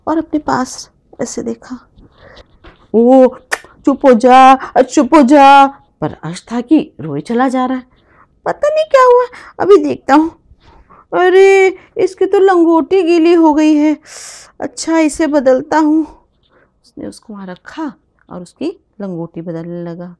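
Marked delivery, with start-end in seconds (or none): 2.9-5.7 loud hushing. Acted. Big brother loudly hushing his crying baby brother. 8.4-16.0 loud, high flustered talk; flustered big brother. Acted. Boy loudly fretting over his wet baby brother.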